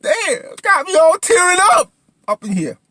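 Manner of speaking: loud, very high wail; tearful mock outburst. Viewer moved by Jennifer's acting, hammed-up tearful wail. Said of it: "I was tearing up right there".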